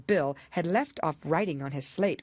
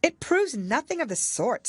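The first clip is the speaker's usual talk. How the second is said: put-on higher, bolder girl's voice; indignant Alice; 10 semitones higher, 3 dB louder than usual. A narrator's reading. Alice contradicting the queen, indignant. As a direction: object firmly as a young girl.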